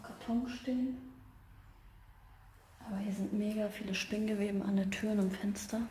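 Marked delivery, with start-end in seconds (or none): 2.9-5.8 quiet whisper; whisper, keep it hushed; nervous night vlog whisper. Real, not acted.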